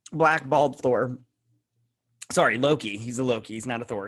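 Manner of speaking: ordinary talk, nothing marked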